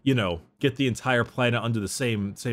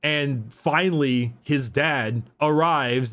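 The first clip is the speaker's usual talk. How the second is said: normal talking voice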